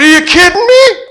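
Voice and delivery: high, loud delighted exclaim; thrilled disbelief. Retold or imitated. Son offered the wheel, thrilled. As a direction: burst out in excited disbelief.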